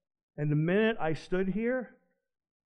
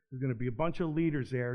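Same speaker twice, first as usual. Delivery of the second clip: ordinary delivery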